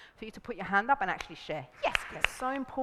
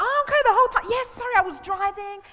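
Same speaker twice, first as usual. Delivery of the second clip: high, loud mock relief; sudden realisation; 10 semitones higher, 8 dB louder than usual. Caller hearing the driving excuse, sudden realisation. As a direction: act out the penny dropping.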